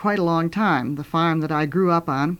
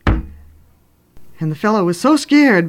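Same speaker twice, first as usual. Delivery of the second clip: ordinary delivery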